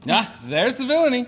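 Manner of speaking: a quick, loud, high gotcha; gleeful pounce. Acted. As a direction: catch him out with relish. Master pounces on the fault.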